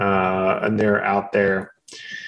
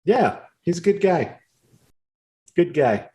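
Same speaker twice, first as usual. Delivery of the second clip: friendly, higher NPC voice, rambling and quick; chatty harvest worker Finepe; 4 semitones higher than usual. Acted. Harvest worker chatting up the newcomers, higher and rambling. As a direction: play a rambling, eager-to-please local who loses her words.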